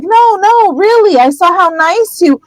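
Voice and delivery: high, over-eager backpedalling; frantic fake reassurance. Hermione frantically insists on flattery.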